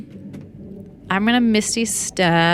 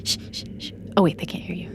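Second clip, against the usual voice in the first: hushed unvoiced whisper; urgent shushing whisper; 8 dB quieter, faster than usual. Hushing a noise.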